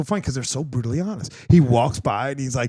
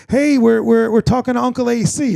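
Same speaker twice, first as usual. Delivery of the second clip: higher, louder imitation of eager relatives; retold calling out; 7 semitones higher, 4 dB louder than usual. Family hailing Ducka D during a FaceTime, calling out. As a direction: call a passer-by over to the video chat.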